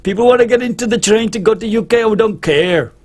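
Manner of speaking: high, loud mocking voice for the French; mock French officials. French official shrugging off the UK, mock loud voice. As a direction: brush off the UK like a careless official. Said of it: "So we said".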